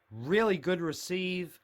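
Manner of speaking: ordinary talk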